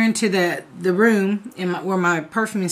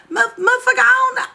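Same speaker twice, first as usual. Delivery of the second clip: exasperated. Retold or imitated. Exasperated at brother.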